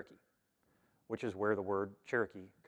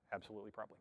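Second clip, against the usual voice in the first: dry ironic echo; 13 dB quieter, much faster than usual. Shown as a demonstration. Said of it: "a scholar's favorite two words".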